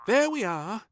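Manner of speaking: high, loud bright maid. A narrator's reading. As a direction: finish the job cheerfully.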